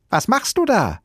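put-on high, loud child-character call; little boy's piercing call upward. A narrator's reading. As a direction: shout the question up to someone far above. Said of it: "rief der kleine Häwelmann hinauf".

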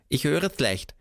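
put-on high old raccoon voice; deaf raccoon. Raccoon telling Neinhorn he hears badly, high. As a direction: explain you hear badly. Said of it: "sagte der Waschbär".

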